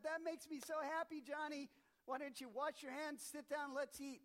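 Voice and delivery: a pleased mother calling her son to dinner; mom's voice. Retold or imitated. Mom voiced in story.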